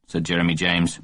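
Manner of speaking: ordinary delivery, nothing marked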